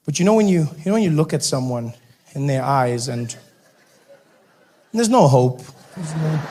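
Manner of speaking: normal talking voice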